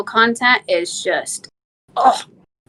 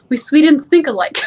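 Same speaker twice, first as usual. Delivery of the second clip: hint of a Swedish accent, laughing; a touch of playful Swedish lilt; 3 semitones higher, 4 dB louder than usual. Guest jokes about fellow Swedes, mock Swedish accent. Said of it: "you started pulling the accent there".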